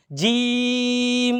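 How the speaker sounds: জিম stretched very slowly; টেনে জিম; drawn-out letter. Shown as a demonstration. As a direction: hold জিম long like a recitation. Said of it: "জিমের হলো বরকত জ".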